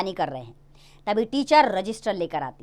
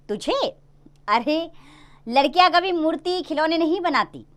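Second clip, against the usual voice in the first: father brushing her off, voice pitched up; dismissive father; 7 semitones higher than usual. Acted. Father dismissing his daughter's wish, a hint of scorn. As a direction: scoff at the idea.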